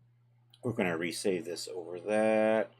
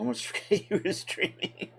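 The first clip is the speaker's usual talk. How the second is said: laughing whisper; 11 semitones higher than usual. Real, not acted.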